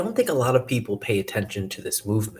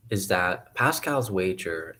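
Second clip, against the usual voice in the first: ordinary delivery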